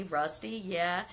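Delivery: ordinary delivery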